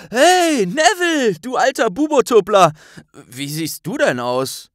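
very high, loud cheerful call. Acted. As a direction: hail a friend across the café. Ron ruft Neville lautstark zu. (lauter, fröhlicher Zuruf an Neville)

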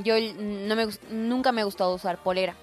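ordinary delivery

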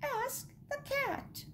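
very high, soft chick voice; chirping chicks. A narrator's reading. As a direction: pipe it up softly like a baby chick. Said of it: "chirped the chicks".

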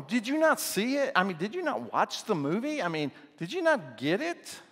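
high-pitched mock disbelief at the question; incredulous. Husbands to unimpressed wives after a comedy, mock incredulous. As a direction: plead the case, voice jumping up in playful astonishment.

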